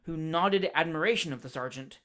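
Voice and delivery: ordinary delivery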